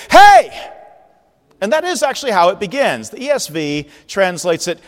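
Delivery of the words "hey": very high, loud shout of Hey. Shown as a demonstration. Preacher updating the prophet's cry, shouting Hey. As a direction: yell it to grab attention. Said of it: "I would say".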